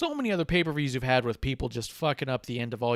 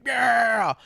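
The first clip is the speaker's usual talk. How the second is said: imitated yell; 10 semitones higher, 8 dB louder than usual. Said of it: "he's like".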